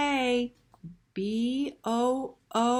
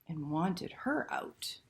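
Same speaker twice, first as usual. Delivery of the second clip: hushed story whisper; soft secretive whisper; 3 semitones lower, 6 dB quieter than usual. A narrator's reading. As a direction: breathe it out softly. Spooky refrain in story.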